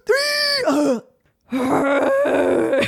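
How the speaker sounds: high wailing scream cut short; death cry. Pretend. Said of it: "give me a Superman mega death scream".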